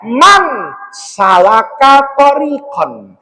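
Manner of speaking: slow, chanted Arabic recitation; hadith recitation. Real, not acted. Cleric reciting the hadith to his audience, slow and chanted. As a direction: intone the hadith like scripture.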